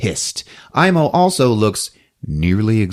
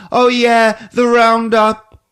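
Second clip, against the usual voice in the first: put-on high little boy, slowing at the end; kid voice; 12 semitones higher, 4 dB louder, slower than usual. Boy remembering the roundup with his dad, high kid voice.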